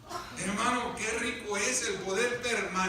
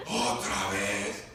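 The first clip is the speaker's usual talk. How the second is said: very low, drawn-out grumble; carcelero ya no amable; much slower than usual. Acted. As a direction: drag it out deep as an annoyed jailer. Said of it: "ya no era tan amable".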